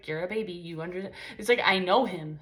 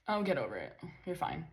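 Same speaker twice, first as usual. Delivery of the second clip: low, softer, dismissive brush-off; mean dismissal; 4 semitones lower, 9 dB quieter than usual. Retold or imitated. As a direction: imitate a cold partner shrugging it off. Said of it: "in a mean way".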